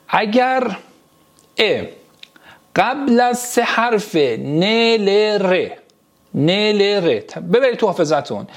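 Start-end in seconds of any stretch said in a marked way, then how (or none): none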